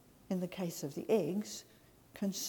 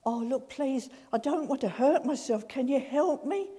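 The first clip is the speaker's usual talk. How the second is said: higher, louder anxious begging; pleading man; 5 semitones higher, 6 dB louder than usual. Acted. Man on the ledge pleading with God, higher and louder. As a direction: plead not to get hurt.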